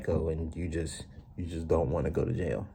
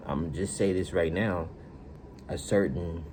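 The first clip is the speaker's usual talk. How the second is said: ordinary delivery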